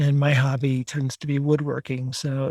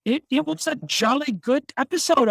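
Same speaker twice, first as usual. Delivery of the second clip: mock British accent; 9 semitones higher than usual. Jokey sign-off in British voice.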